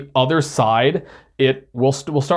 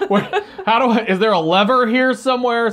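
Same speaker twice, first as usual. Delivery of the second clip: laughing; 9 semitones higher than usual. Retold or imitated. Laughing, imitating searching for lever.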